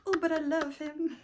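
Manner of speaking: playful copy of a friend's gushing description; mock imitation of cohost. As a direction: mimic her friend's doting tone, laughing through it. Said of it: "You literally sound like me describing my exes".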